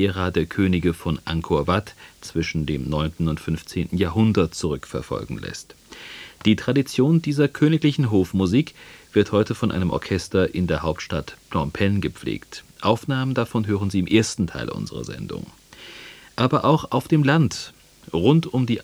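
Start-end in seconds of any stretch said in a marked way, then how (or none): none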